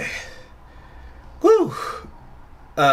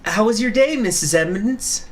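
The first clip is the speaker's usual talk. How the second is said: higher female character voice; 10 semitones higher than usual. Acted. Voicing female judge character.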